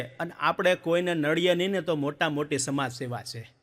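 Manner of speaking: ordinary delivery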